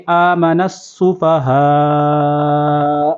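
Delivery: آمن السفہاء slowly with the n merged into a doubled s. Shown as a demonstration. Teacher shows merging the nun into السفہاء. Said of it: "نون کو سین کے ساتھ ملائیں گے".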